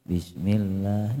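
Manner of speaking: slow, soft, low Bismillah; hesitant soft reading, drawn out; imitating quiet recitation. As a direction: mimic a reader who goes too quiet. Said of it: "Deh, pelan lagi".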